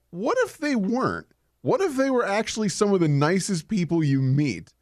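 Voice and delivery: high-pitched eager musing; acted brainstorm. Designer pitching himself nice drow, eager and high. Acting out his own brainstorm.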